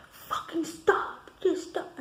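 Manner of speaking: high-pitched mock scream, drawn out; retold yelling. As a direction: imitate a furious screamed demand. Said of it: "she's yelling at me".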